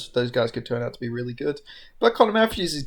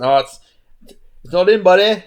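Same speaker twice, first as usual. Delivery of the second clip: put-on a botched British accent, a touch louder; bad English accent; 3 semitones higher, 8 dB louder than usual. Mocking Bisping's call in a bad English accent. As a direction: mangle a British commentator's voice. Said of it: "that was horrible".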